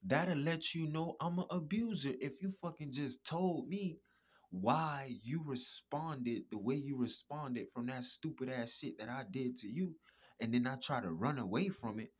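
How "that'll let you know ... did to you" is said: usual speaking voice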